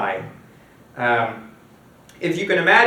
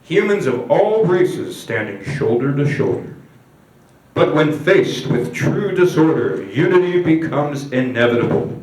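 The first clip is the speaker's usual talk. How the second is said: solemn promo film voiceover; grand, measured screen narrator; propaganda narrator; 3 dB louder than usual. Acted. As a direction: intone it like a propaganda reel. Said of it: "and the narrator comes in".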